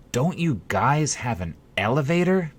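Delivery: a man on crutches who can't believe it; incredulous. A narrator's reading. Man on crutches asks the host, incredulous. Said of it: "looked at me incredulously".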